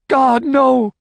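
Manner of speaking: high, loud scream of horror; anguished scream. Acted. Woman screams as her husband is shot, horrified.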